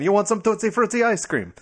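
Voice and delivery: high-pitched, hammed-up Italian accent; mock Italian accent. Shown as a demonstration. Co-host proving the accent is Italian, hammed-up Italian accent. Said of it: "That's a, an Italian".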